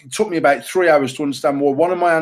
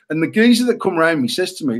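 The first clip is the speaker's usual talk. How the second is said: usual speaking voice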